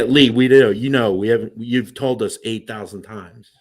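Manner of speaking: ordinary delivery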